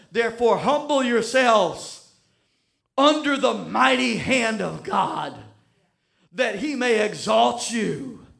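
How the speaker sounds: loud, slow, weighty reading; scripture read aloud. Acted. Preacher reading First Peter aloud to the church. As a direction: read each phrase with weight.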